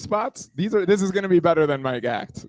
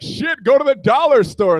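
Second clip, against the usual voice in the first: higher, louder exclamation; mock panic; 6 semitones higher, 9 dB louder than usual. Budget runs out mid-decorating, mock panicked shout. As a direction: blurt it out loud and high.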